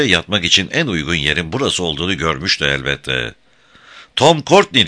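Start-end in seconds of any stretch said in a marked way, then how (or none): 4.2-4.9 high, loud hail; shout. A narrator's reading. Guy shouting to Tom hidden in the dark. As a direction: shout the name across a distance. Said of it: "diye bağırdı Guy".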